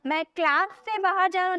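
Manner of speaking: ordinary talk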